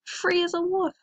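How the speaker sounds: high, elated storybook cry; excited yelp. A narrator's reading. Moika feeling free as a wolf, high elated yelp. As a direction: let it soar high and drawn with joy. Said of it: "he yelped".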